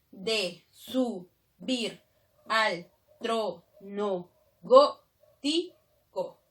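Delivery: very slow, every syllable separated; deliberate scansion with pauses between syllables. Shown as a demonstration. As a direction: count out the verse syllable by syllable. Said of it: "Si yo separo nada más".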